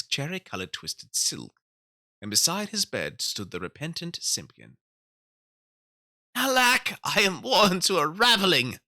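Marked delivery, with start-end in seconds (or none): 6.3-8.8 put-on high, loud tired old tailor; weary old tailor. A narrator's reading. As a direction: sigh it out like a worn-out old tailor. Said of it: "said the Tailor of Gloucester".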